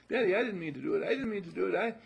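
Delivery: put-on high, loud little-kid plea; pleading child. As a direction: beg Daddy like a guilty child. Kid runs to father.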